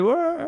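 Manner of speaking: high yell to kick things off; intro shout. Shown as a demonstration. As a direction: open with a whoop. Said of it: "¿A-, aquí al grito?".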